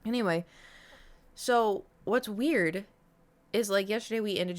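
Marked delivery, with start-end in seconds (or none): none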